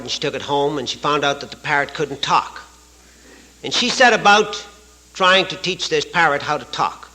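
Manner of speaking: ordinary delivery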